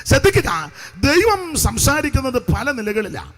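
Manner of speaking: loud, emphatic scripture proclamation; full-force preaching. Real, not acted. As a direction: drive each phrase out to the congregation. (ഉച്ചത്തിൽ ഊന്നിയുള്ള പ്രസംഗം)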